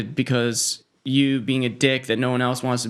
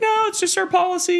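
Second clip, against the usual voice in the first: a lightly voiced polite host, much higher; imitating the host. Host answering the angry customer, high mock politeness. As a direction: play the calm host keeping her cool, pitched way up. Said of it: "And she's like".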